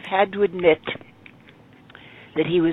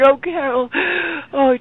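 high, gushing cry of the name; a mother overcome with pride; mother gushing over her daughter, mild mock; 8 semitones higher than usual. Mother gushing in retold scene.

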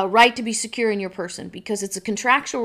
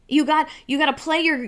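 normal talking voice

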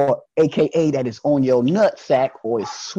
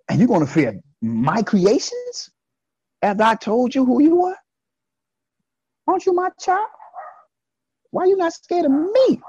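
a rising higher, pleading father's reproach; the Lord asking his child why she fears others more, higher and higher; the Lord scolding; 9 semitones higher than usual. Retold or imitated. The Lord chiding his child, rising higher. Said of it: "That's what the Lord says".

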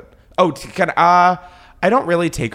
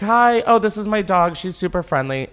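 bright greeting; 7 semitones higher than usual. Retold or imitated. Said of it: "I was like".

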